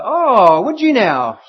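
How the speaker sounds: loud, high, sly "oh, would you now"; mocking father. Father teasing his son before giving a snake, mock-sly. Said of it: "and the father says".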